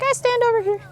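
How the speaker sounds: high squeaky voice for the horse, lightly voiced; horse's voice. Trainer voicing her horse, in a high squeaky voice. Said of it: "He's like".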